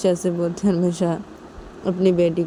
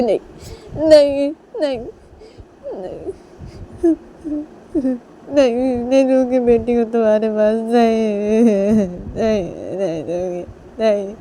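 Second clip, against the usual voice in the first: tearful refusal; 4 semitones higher than usual. Acted. Nisha clutches her baby from Manisha, tearful refusal. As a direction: wail the refusal with long tearful vowels.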